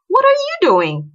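loud, high-pitched comeback; indignant retort. A narrator's reading. Boy throwing the girl's question back at her, loud indignant retort.

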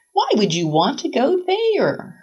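high, slow, disbelieving question; baffled mother. A narrator's reading. Mother asks her daughter about moving away, baffled.

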